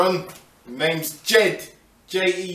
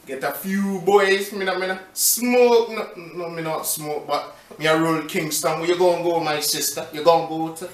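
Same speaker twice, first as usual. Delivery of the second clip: lightly put-on Jamaican patois, laid back; a hint of a Jamaican accent; 3 semitones lower than usual. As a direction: play a chilled Jamaican guy, lightly. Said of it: "in Jamaican accent".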